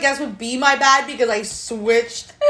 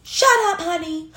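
imitating a small girl bossing her sister; bossy toddler; 7 semitones higher than usual. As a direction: snap it like a cheeky toddler. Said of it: "she'll be like".

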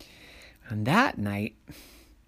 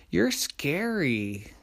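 higher, drawn-out fearful answer; scared monsters; 5 semitones higher, much slower than usual. A narrator's reading. Monsters confessing to the little person, scared. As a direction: stretch it out like a nervous monster.

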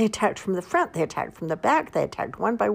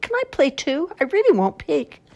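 much higher, louder hopeful bunny voice; 11 semitones higher, 6 dB louder than usual. Acted. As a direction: beg to join as an eager small bunny. Bunny voiced in children's story.

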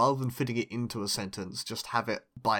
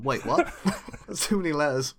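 ordinary delivery

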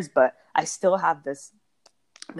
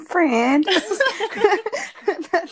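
laughing; 12 semitones higher, 6 dB louder than usual. Real, not acted. Moved friend laughs through tears.

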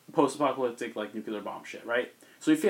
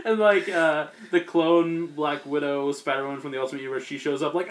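ordinary talk

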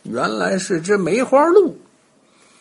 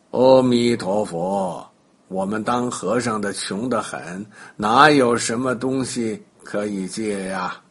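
pious monk; 7 semitones lower than usual. A narrator's reading. 假唐僧装穷和尚. (假唐僧装成老实和尚，低沉缓慢)

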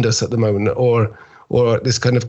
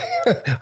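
laugh; 3 semitones higher, 5 dB quieter than usual. Real, not acted. Laughs at own joke.

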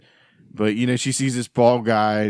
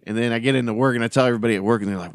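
usual speaking voice

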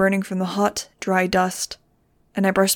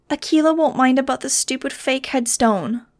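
miserable; 5 semitones higher than usual. A narrator's reading. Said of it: "Nudge answered miserably".

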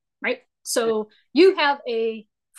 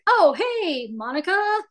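higher, bright hail to a friend; cheerful greeting; 7 semitones higher than usual. Acted. Tipping off a friend, cheerful greeting. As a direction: call out a friendly hello.

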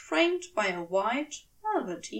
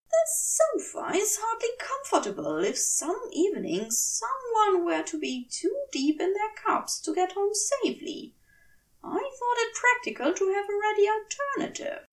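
higher, fussy voice of a fastidious angel explaining himself; prim angel voice rushing through an excuse; flustered angel; 8 semitones higher than usual. A narrator's reading. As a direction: talk it up fast and prim, too defensive. Aziraphale justifies the guest bed.

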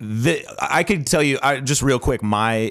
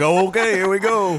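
bracing; 10 semitones higher than usual. Retold or imitated. Retold bracing reaction.

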